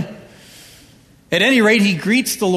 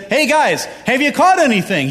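pitched well higher, friendly call across the water; calling out from shore; 7 semitones higher than usual. Acted. The Lord calls to the disciples in the boat, cheerful and high. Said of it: "he's basically saying to them".

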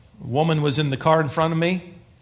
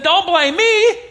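high, louder pleading excuse; Adam's plea; 13 semitones higher, 4 dB louder, slower than usual. Retold or imitated. Adam excusing himself to God, high pleading whine. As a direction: whine it like a caught child. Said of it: "Adam said".

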